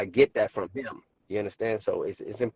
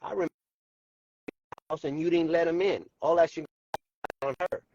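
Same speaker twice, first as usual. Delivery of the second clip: choppy cutting-out audio; breaking up; 4 semitones higher than usual. Real, not acted. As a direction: signal dropping in and out. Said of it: "breaking up".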